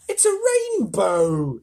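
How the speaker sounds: slow, loud cry of wonder; amazed cry. A narrator's reading. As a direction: call it out in awe, drawn out. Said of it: "everyone said at once".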